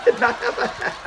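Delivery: high, breathless laughing words; helpless laughing. Real, not acted. Host losing it over the sitcom joke, laughing hard. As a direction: squeeze the words out through real laughter.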